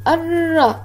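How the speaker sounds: الر as "ar-ra", rolled r released into a vowel; voweled second r. Shown as a demonstration. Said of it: "المرة الثانية متحركة".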